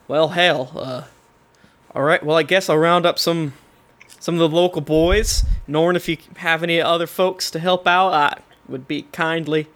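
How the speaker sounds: put-on easygoing Southern constable accent. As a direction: round things off in a folksy Southern accent. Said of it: "has a southern accent saying that".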